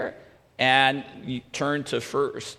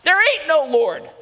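high, loud angry retort; angry shout; 11 semitones higher, 5 dB louder than usual. Acted. Atheist neighbor answering her proclamations, angry shout. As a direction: shout back hotly, pitched high. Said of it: "get so angry ... he would shout".

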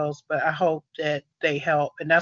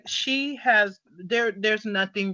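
passionate; 6 semitones higher, 6 dB louder than usual. Real, not acted. Passionate defense of her friend.

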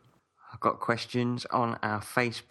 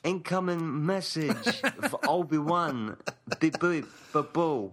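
higher robotic announcement; droid voice; 7 semitones higher than usual. Acted. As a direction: chirp like a droid. Droid message impression.

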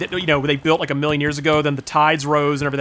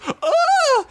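a very high, sudden shrieked "Ah"; mock startled scream. Stallone's daughter jumps at the fish, a high shriek. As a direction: shriek like you just got scared. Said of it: "from the scare".

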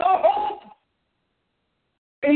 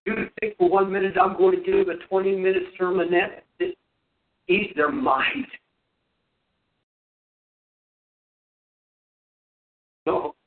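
preaching; 10 semitones lower than usual. Real, not acted. Preaching at full force.

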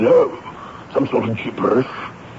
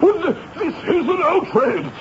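high, stammering outrage; flustered sputter. Acted. Exposed man to the major, high flustered sputter. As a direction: sputter in panic.

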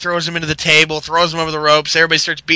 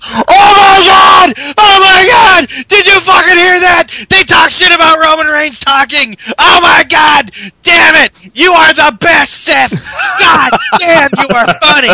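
crowd screaming with shrill delight, much higher and louder; screaming fans; 11 semitones higher, 8 dB louder than usual. Retold or imitated. Fans screaming praise at Seth, wildly excited. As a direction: scream like a hyped fan in the stands. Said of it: "was like".

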